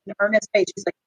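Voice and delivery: normal talking voice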